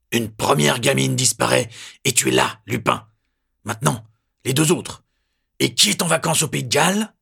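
loud, high accusing voice; angry accusation. A narrator's reading. Lieutenant accusing Lupin, loud and high. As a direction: hurl the charge at him.

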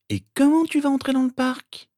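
put-on very high girl's voice; practical sister. A narrator's reading. Sister questioning how he'd get in, matter-of-fact. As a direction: challenge his plan in a high voice. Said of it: "demanda Rose avec bon sens".

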